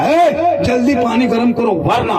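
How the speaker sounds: high and loud barked command; loud bossy order. Retold or imitated. Husband barks at his wife for hot water. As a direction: shout the order, ending on a threat. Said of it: "शोहर कहा".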